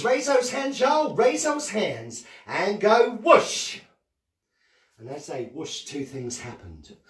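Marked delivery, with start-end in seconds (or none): 0.0-3.8 put-on high, loud rallying call to kids; excited call-out. Shown as a demonstration. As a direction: whip the children up. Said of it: "raise your hands".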